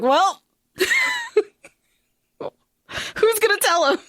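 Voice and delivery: quip broken by a laugh, rising high. Retold or imitated. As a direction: laugh mid-line, then ask it. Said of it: "And I was like".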